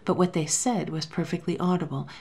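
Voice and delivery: ordinary delivery, nothing marked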